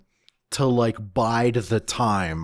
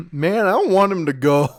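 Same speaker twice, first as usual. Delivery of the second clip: high, louder emotional plea; real dismay, pitched way up; upset; 9 semitones higher, 4 dB louder, faster than usual. Real, not acted. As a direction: protest it in a high, pained voice. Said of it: "I'm gonna start crying".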